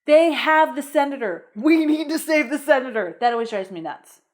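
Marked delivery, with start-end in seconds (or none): 0.1-3.2 mock urgent hero.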